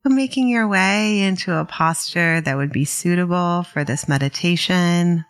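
calm, unhurried meditation guidance with long pauses; guided meditation. Real, not acted. Meditation teacher guiding listeners to settle in, calm and unhurried. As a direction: ease listeners into settling, leaving space between phrases.

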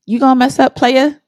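high, loud, in-your-face warning; loud and blunt. Acted. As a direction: call them out, loud and tough.

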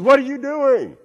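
high, loud drawn-out holler; yelling out. Acted. Boy demands answers from the unseen voice, yelling out. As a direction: yell it high across a canyon. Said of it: "he yelled out".

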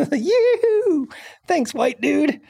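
high, hammed-up happy cheer; mock gleeful locals. Host voicing the just-paid local folks, high mock glee. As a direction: squeal mock thanks in a high voice.

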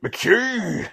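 high, loud outburst; imitating an appalled bishop's shout; shocked recognition. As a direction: yelp the surname in mock horror. Bishop suddenly recognises old troublemaker.

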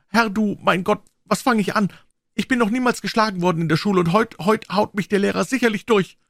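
put-on high, tearful girl's voice scolding; upset girl. A narrator's reading. Becky scolds Tom for catching her, high tearful voice. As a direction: scold him through shame and tears. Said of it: "brach in bitteres Schluchzen aus".

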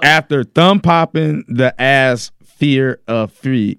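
half-speed, each word set apart; slow deliberate rereading. Real, not acted. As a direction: reread it word by word for confused listeners. Rereads garbled sentence for confused co-hosts.

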